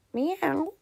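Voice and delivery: high meow; cat meow. A narrator's reading. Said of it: "said the cat".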